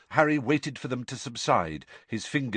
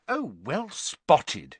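slow, higher-pitched acid sarcasm; 7 semitones higher, much slower than usual. A narrator's reading. Hermione answers Ron's late discovery, acidly. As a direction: draw out the mock congratulation. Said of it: "she said acidly".

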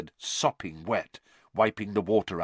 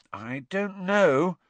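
slow, higher-pitched hesitation; reluctant and slow, pitched up; slow, hesitant; 10 semitones higher, 5 dB louder, slower than usual. A narrator's reading. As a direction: draw it out, high and doubtful. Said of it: "Hermione said slowly".

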